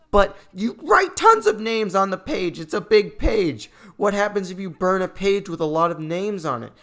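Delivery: high, loud outburst of disbelief; exasperated rant. Real, not acted. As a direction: rant at full volume at the nonsense. Ranting at illogical movie rule.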